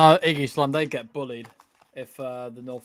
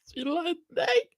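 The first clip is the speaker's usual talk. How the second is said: mock crying; 13 semitones higher than usual. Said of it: "Sorry, I'm gonna cry".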